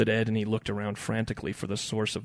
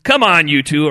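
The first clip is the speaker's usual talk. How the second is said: loud, raised, exasperated appeal; pleading; 6 semitones higher, 15 dB louder than usual. A narrator's reading. Brother breaks up the fishing squabble, pleading. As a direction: plead for them to quit it. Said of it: "Earl pleaded".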